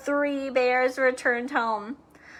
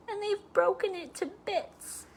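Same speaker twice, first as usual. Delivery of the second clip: put-on high, sad little baby bear; a cub upset about his chair; wee small voice; 7 semitones higher, 5 dB quieter than usual. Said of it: "in his wee small voice".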